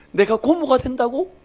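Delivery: high, slow disbelieving question; can't believe the news, ask it slowly; amazed question. Retold or imitated. Daughter learning she'll be an aunt, high slow amazed question.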